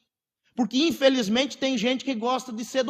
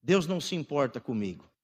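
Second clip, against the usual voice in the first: lightly acted victim, low and soft; understated woe-is-me, pitched well down; mild self-pity; 7 semitones lower, 4 dB quieter than usual. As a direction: play the victim quietly, in a low voice. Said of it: "nos vitimizamos, dizendo".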